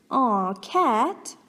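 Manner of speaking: ordinary delivery, nothing marked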